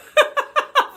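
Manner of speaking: high, loud squeal of laughter. Real, not acted. Laughing fit.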